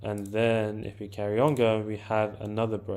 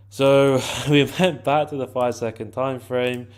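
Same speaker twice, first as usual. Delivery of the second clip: laughing through it, a bit louder; amused repeat; 3 semitones higher, 7 dB louder than usual. Real, not acted. Trader repeats the step to viewers, laughing. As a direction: let a laugh break in, then restate.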